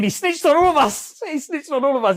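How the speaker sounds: high, loud mock outrage; squeaky disbelief; indignant. Real, not acted. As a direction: cry out at the betrayal. Outraged at the betrayal.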